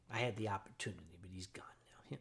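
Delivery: quick, soft whisper; whispered regret about Giza; whispered aside. Real, not acted. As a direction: mutter it under your breath. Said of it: "whispered aside".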